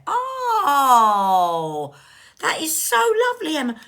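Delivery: emotional gush. Real, not acted. Moved by the card's message.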